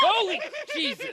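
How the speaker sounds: sudden high frightened yelp; startled yelp. Real, not acted. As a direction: jump at a scare. Actor scares the guest.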